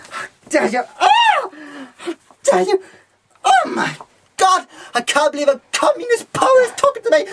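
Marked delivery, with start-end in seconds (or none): 1.0-1.5 very high shocked shriek; outraged shriek. Acted. 2.0-7.3 fast, much higher tearful shout; hysterical cry. Acted. Hysterical outrage at stranger.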